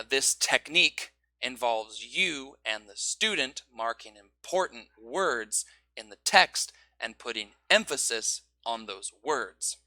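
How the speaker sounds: slow, key words pushed; slow emphasis on marked words; marked important words. Shown as a demonstration. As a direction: lean on each marked word. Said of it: "mark the important words".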